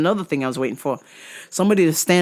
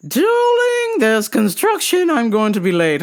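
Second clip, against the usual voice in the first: mother's voice; 7 semitones higher, 3 dB louder than usual. Retold or imitated. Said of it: "the mother".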